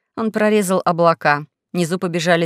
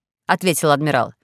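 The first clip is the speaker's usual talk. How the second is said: plain reading voice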